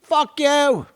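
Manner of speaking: high British swearing, word by word; mock British. Showing how the British curse, high mock British voice. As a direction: spit out insults in a cartoon British accent. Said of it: "If you're British, everything's a".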